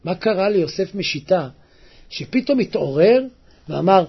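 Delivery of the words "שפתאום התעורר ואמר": normal talking voice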